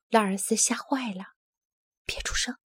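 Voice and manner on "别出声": urgent whisper; frightened bear hiding from hunters, whispering. Acted. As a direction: hush him without voice. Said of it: "他小声说".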